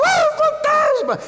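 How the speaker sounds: high, slow frightened shriek of ghost; mock scared cry. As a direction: squeal in mock terror at a ghost. Apóstolos assustados gritam. (grito assustado e agudo de medo de fantasma)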